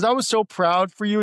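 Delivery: high, loud, gushing imitation of an excited friend; excited friend retold. Friend retelling, burst of excitement.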